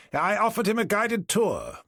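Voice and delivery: high, slow, grandly generous; magnanimous. A narrator's reading. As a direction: play the gracious host. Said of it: "Forrester's magnanimous reply".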